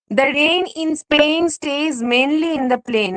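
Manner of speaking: The rain in Spain, slow, each ai stretched out; slow example reading. Shown as a demonstration. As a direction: read it very slowly so the rain, Spain, plain vowels ring. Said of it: "শুনতে গেলেই বুঝতে পারছো". (খুব ধীরে, rain-Spain-plain এর ai টেনে)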